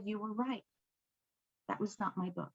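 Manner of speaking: barely above a breath, owning the mistake; tearful admission. Retold or imitated. Client crying to her coach, softly tearful. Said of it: "she just started crying".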